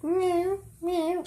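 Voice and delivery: tiny high meows imitating a cat; mock cat meow. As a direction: squeak out two soft cat meows. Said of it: "She was like".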